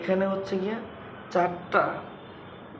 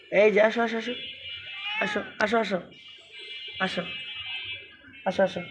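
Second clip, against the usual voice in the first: coaxing call; 4 semitones higher, faster than usual. Real, not acted. Beckoning the animal closer.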